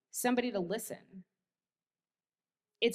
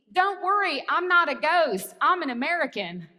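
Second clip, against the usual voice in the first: put-on twangy American accent, raised and louder; 6 semitones higher, 7 dB louder than usual. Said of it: "all twangy".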